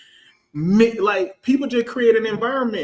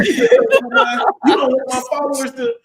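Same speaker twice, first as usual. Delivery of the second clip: mock whiny follower; 7 semitones higher, 7 dB louder, slower than usual. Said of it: "They're like".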